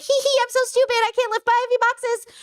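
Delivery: put-on high, silly giggly girl voice, slowing down; mock helpless girly voice; mock ditzy girl. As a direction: play dumb in a squeaky giggle. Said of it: "women are like".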